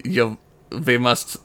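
slow, halting mock German accent; a stab at a German voice. Cohost trying a German accent on a dare. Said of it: "I'm doing, like, a German".

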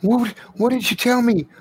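put-on very high, insistent little kid; eager child voice. Child runs over to Reggie demanding answers, high eager child voice. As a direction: pepper them with questions in a high child's voice.

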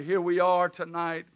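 usual speaking voice

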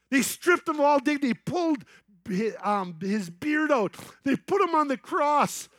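high, loud, driving sermon climax; preaching at full force. Acted. Preacher pours a Passion Play story on the congregation, loud and soaring. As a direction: preach it out, building with every line.

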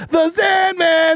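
mock ring announcer shout; hype shout. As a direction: boom it like a wrestling intro. Hyping his own alias.

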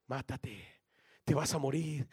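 a hint of a low, soft inner voice; tempting voices. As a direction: murmur the deathly temptation. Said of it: "yo oía las voces".

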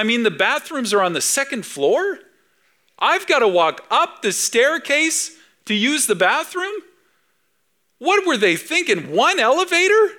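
high, exasperated griping; annoyed tourist. Pretend. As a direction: complain like a tourist stuck waiting. Impatient visitor in the CN Tower lobby.

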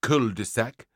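"cul-de-sac" with affected French vowels; mock French accent. A narrator's reading. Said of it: "The teacher did the same when pronouncing".

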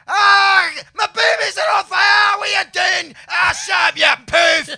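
full-volume Scottish caricature, pitched very high; over-the-top Scottish rant, shouting at full pelt; angry shouted Scottish impression. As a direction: bellow it as an angry cartoon Scotsman. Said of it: "started doing my own Scottish impression".

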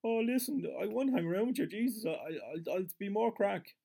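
pleading whine. Retold or imitated. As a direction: beg like a clingy fool. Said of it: "He's going".

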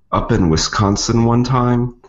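normal talking voice

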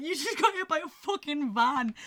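high, loud, incredulous retelling; mock helpless amusement; retold crying with laughter. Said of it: "I'm just crying with laughter".

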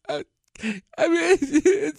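high, tearful wailing imitation of wife; imitating crying wife. Husband imitating his tearful wife. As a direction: mimic her sobbing, voice cracking up high.

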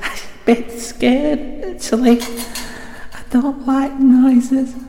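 high-pitched, scared little voice; silly put-on voice. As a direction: daft high voice of a frightened ghost hunter. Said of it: "I don't know why I'm doing this voice".

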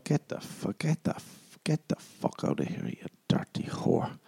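hammed-up hushed disgust; mock whispered scolding. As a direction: whisper a stumbling telling-off. Acted scolding of public lovers.

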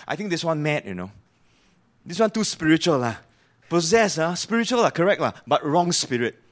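imitating colloquial Singlish with lah; lightly put-on Singlish gossip about a madman. Preacher voicing townsfolk gossiping about John in colloquial Singlish. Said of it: "in that language, it's actually saying".

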